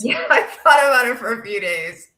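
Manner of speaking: mocking first-person quote through laughter; mock confession. Co-host parodying the woman's account, mock confession. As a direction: play her part with amused scorn.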